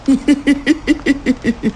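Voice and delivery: high giggle. A narrator's reading. Strange man giggling before vanishing, eerie giggle. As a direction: giggle away into the dark. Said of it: "আবার খিলখিল করে হেসে উঠল".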